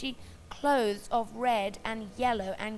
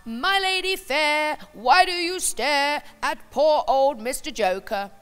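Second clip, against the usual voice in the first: saucy clown; 4 semitones higher, 10 dB louder than usual. Acted. Clown teasing the prim China princess, saucy and loud. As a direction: tease her like a cocky jester. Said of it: "scousely he said".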